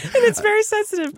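giggling through the words; laughing. Real, not acted. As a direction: laugh while repeating it. Echoing partner's line, laughing.

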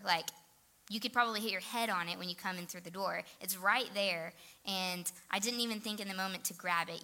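normal talking voice

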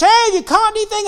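high, loud call across the water; shouting from the shore. Retold or imitated. Stranger on the shore shouting to the fishermen, loud call. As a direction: call out to the boat. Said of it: "and shouts".

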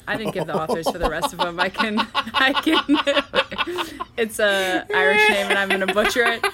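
high, loud, laughing explanation; helpless laughter breaking up the words; laughing while talking. Real, not acted. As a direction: talk through a fit of giggles.

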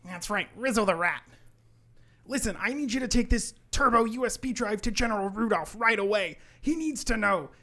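high, loud, insistent briefing; urgent elf. Acted. Elf sending rat to General Rudolph, urgent. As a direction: press the mission on them, building to the last line. GM voices Hermie giving mission.

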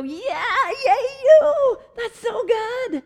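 high, loud gushing cheer; squealing yay you for a friend; excited cheer. Pretend. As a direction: cheer them on with delight.

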